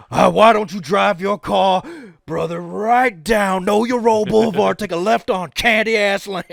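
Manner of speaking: loud trash-talking wrestler; wrestling promo shout. Acted. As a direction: bellow it like The Rock. The Rock promo parody.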